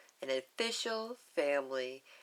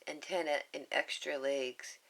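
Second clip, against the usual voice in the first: plain reading voice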